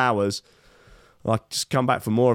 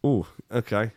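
ordinary talk, nothing marked

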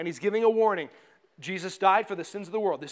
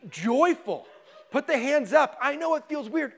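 higher, louder rallying call; joyful exhortation; 8 semitones higher, 5 dB louder than usual. Real, not acted. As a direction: rouse the congregation to worship. Rousing congregation to joyful worship.

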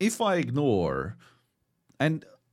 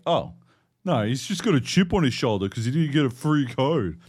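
dismissive critic voice; 4 semitones higher, 6 dB louder than usual. Retold or imitated. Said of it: "anyone tried to be like".

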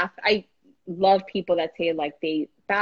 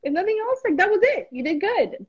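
coaxing high voice; 13 semitones higher than usual. Retold or imitated. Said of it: "And I'm like".

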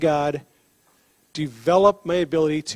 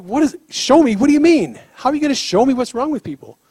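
higher, louder confused questions tumbling out; baffled protest; 7 semitones higher, 4 dB louder, faster than usual. Retold or imitated. Paramedic questions God in disbelief, higher and confused. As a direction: ask it fast, mystified. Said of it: "I'm like".

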